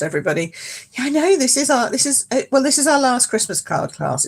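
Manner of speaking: ordinary talk, nothing marked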